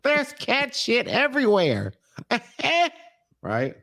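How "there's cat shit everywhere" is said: high, drawn-out put-on wail; mock sheepish confession. Joking as Debbie warning about the house, high mock confession.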